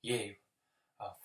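halting put-down, broken by deliberate pauses; contemptuous confrontation. Acted. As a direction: spell it out piece by piece to someone beneath you. Confronting Bennett over name-calling.

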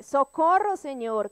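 grita socorro alto, como pidiendo auxilio; un clamor fuerte al Señor. Retold or imitated. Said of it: "dice nuevamente". (clamor fuerte de auxilio)

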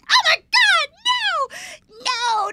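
high-pitched horrified shriek; screaming. Retold or imitated. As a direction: re-enact a loud, panicked scream. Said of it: "I'm, like, screaming".

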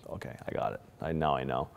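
barely voiced, near-whisper; whispered quiet acceptance; hushed resignation. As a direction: breathe it out as if accepting the end. Inner voice calmly accepting death.

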